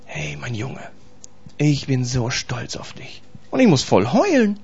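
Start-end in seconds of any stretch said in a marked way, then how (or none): 0.1-3.2 very soft, slow, low fatherly voice; hushed and tender, lingering on the pride. Acted. Jesus stroking his son's head, proud and tender. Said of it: "mir über den Kopf streichelt und sagt".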